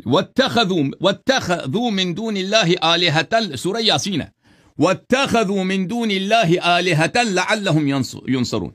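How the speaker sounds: slow, drawn-out Arabic recitation; Quran recitation. Acted. Scholar re-reciting the verse correctly to debaters, slow recitation. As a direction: draw out each word of the verse.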